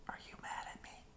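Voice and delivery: breathy secret whisper. Acted. Psst, asking in secret.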